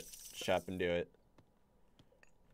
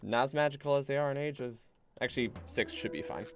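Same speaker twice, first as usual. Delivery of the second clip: normal talking voice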